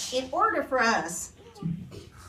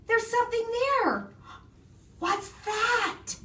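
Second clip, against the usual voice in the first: high-pitched alarm; startled, pitched well above narration; 13 semitones higher than usual. A narrator's reading. Eddie spotting something in the woods, startled.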